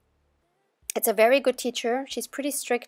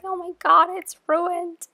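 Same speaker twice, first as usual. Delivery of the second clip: high-pitched, louder panicky outcry; mock panic; 8 semitones higher, 4 dB louder than usual. Crafter imitating herself panicking over a stuck punch, mock panic. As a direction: act out panicking over a ruined tool. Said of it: "I'm always panicking".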